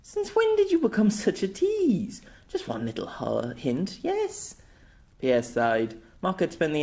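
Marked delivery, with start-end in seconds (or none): 0.0-4.6 put-on high teasing girl voice; playful pout. Girl teasing her guardian for a clue, high pouting voice. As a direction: pout and tease him for the hint. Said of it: "Marka pouted playfully".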